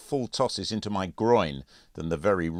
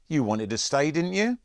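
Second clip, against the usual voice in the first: taunting; 10 semitones higher, 5 dB louder than usual. A narrator's reading. Bully taunting pinned narrator.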